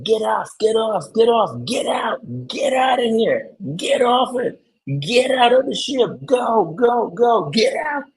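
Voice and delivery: very high-pitched mock yelling; imitated yelling. Traveler imitating crew rushing passengers off the boat, high yelling. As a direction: yell urgently like crew clearing a ship. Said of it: "whistling and just yelling at you".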